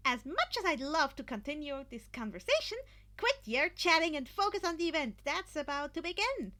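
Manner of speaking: high, loud eager voice; enthusiastic declaration. A narrator's reading. Hatsume telling the team to focus, loud and eager. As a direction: declare it bursting with energy. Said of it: "Hatsume declared, enthusiastically as ever".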